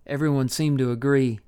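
ordinary delivery

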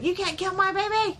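high-pitched, hammed-up outraged cry; mock freak-out. Co-host retelling the boyfriend's reaction to the abortion, mock freak-out. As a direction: imitate him flipping out, voice jumping high. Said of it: "He freaks out".

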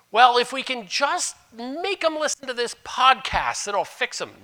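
high naive mock voice; mocking wishful thinking. Pastor mimics churchgoers' easy fixes, high and mocking. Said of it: "No, it doesn't".

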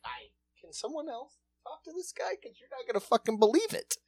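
high, soft disbelieving plea; incredulous aside. Retold or imitated. Asking coworkers to hear the caller, high and hushed. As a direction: ask for help in disbelief. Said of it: "I was like".

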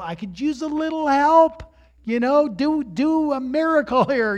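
half-laughing, high-pitched appeal to God; exasperated plea. Retold or imitated. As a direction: plead upward for a little help. Retold pleading prayer to God.

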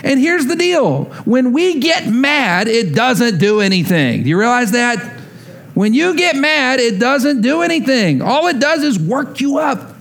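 high, driving preacher voice; impassioned sermon building to a shout; preaching. Acted. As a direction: press the lesson home from the pulpit. Preaching the lesson at full force.

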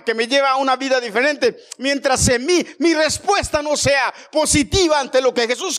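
loud, high, impassioned preaching; preaching at full force. Acted. Pastor preaching hard to the church, fervent. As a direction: preach it out to the congregation.

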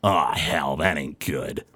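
low, soft mutter of dismay; Gorn. Acted. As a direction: grumble it low, dropping away at the end. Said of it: "Gorn ... looks and he goes".

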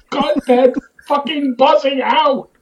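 high, slow yell through laughter; laughing yell. Pretend. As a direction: holler it while cracking up. Yelling at the editor.

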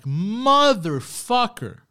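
exasperated mock groan of a fool who just got scammed; frustrated inner cursing. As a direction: drag each motherfucker out in self-disgust. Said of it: "I think to myself".

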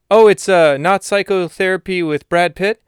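mock-surprised pal poking fun; a hammed-up cheeky sidekick; Salamander's voice. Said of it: "Salamander asked the question".

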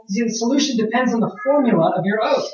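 ordinary talk, nothing marked

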